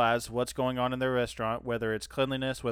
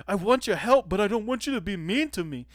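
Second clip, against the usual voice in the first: whiny; 6 semitones higher than usual. Retold or imitated. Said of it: "he's like".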